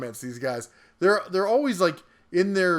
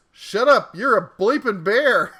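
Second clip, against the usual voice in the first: higher, louder mock rant; mock angry outburst; 4 semitones higher, 6 dB louder than usual. Bear reads out a hater's comment, in mock anger. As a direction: fire it off like an irate commenter.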